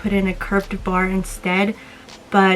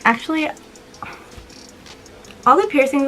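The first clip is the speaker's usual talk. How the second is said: actually said higher with a rising lilt; higher self-correction; 5 semitones higher than usual. Real, not acted. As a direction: correct yourself, lifting actually up high. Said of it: "Well, actually".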